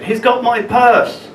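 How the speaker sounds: high, slow retold cry for help; woman shouting after the thief, high and slow. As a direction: shout it like the victim. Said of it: "this woman's voice behind me shouted".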